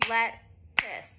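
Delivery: lettuce split into two soft beats; syllable by syllable, let-tuce; soft clapped syllables. Shown as a demonstration. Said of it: "Now let's all do it".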